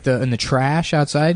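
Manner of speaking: ordinary talk, nothing marked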